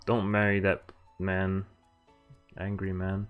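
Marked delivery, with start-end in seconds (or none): none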